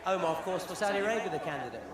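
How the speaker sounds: normal talking voice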